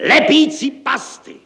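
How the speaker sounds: very high, loud, drawn-out shriek; hysterical shout. A narrator's reading. Director losing his nerve over glue, hysterical shout. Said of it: "volal hystericky".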